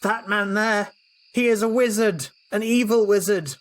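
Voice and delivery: high, loud public accusation; accusing yell. Acted. Alex yelling to the crowd that the pilgrim is an evil wizard. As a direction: denounce him to the whole crowd. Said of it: "I'm gonna yell".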